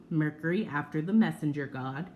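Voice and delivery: ordinary talk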